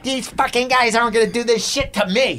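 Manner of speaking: high-pitched mock rant, out of his mind; imitating an enraged comic. Furious comic pacing before going on third, imitated angry rant. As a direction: act out an indignant hothead. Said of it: "He was out of his fucking mind".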